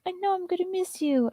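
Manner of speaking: high, sing-song pet voice. Real, not acted. To her dog Muffin, high cooing baby talk. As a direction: coo at the dog.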